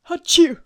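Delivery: loud sudden sneeze; big explosive sneeze; sneezing. Real, not acted. Outtakes full of sneezes.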